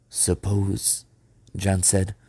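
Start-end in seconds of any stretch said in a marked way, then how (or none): 0.1-1.0 slightly husky hesitation; a touch of huskiness, uneasy; a little husky. A narrator's reading. John doubting the plan to Peter, a little huskily. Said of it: "a little huskily".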